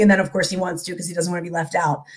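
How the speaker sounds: normal talking voice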